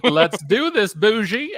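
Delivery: high, loud and delighted, hamming it up; a squeal of being upgraded; mock posh excitement. Telling the cohost about the private check-in, high mock glee. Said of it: "And I'm like".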